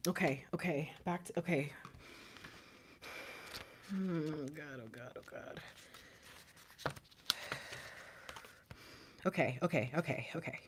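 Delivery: whispered, shaky self-soothing; very soft, barely voiced; composing oneself. Real, not acted. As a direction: murmur it under your breath to steady yourself. Composing herself after crying.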